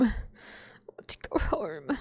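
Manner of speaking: a tired little whisper; whispered plea. Real, not acted. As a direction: whisper it, pleading to go home.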